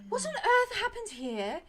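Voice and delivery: high, slow shocked cry; stunned nurse seeing blood; alarmed healer. Acted. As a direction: gasp it out, horrified at the wound.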